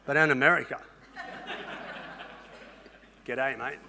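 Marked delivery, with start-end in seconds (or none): 3.2-3.8 low, soft Australian "G'day, mate"; Australian greeting. Acted. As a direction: lay on a quiet Aussie hello. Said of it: "I never say G'day to anybody in Australia".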